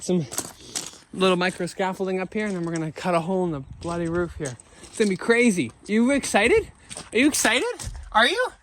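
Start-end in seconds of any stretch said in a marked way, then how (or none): 5.9-8.5 building higher with each question; coaxing the helper, getting higher and more playful; excited. Real, not acted. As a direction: egg the helper on, rising each time. Asking helper, pitch climbing.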